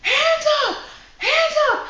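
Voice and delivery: high, very loud shouted command; full-force yell. Retold or imitated. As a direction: yell it like a stick-up. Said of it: "I yell, "Hands up," right at her".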